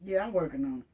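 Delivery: sarcastic low brush-off; mock brush-off. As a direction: throw their excuse back lazily. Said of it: "you know what I'm gonna say".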